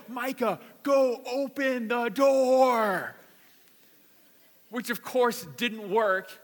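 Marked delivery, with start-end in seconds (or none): 0.1-3.1 high, drawn-out reenacted yell; screaming. Dad screaming through the window to his locked-in son, frantic yell. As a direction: holler it urgently through glass, stretching the words. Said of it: "screaming through the window".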